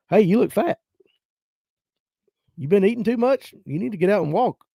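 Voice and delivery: tossing out an insult like it's nothing; blunt southern granny. Retold or imitated. Granny telling her grandkid he looks fat, mock blunt. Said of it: "They were just like".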